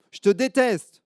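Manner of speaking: put-on high, loud childish yell; loud high I hate you; angry little girl. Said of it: "elle commence à s'énerver".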